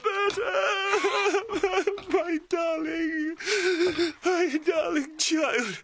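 slow, tearful cry; father sobbing over his child. Acted. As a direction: cry it out slowly. Father clutching his daughter.

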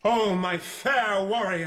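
high, drawn-out stage declamation; Othello greeting Desdemona. Acted. Othello greets his bride in rehearsal, declaimed. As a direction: cry it out slowly to your beloved.

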